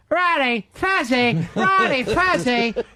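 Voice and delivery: high, drawn-out mock name-calling; bad Ron and Fez impression. Host repeats colleague's bad impressions, high mocking calls. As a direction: badly mimic two radio hosts hailing each other. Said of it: "doing the bad impressions of Ron and Fez".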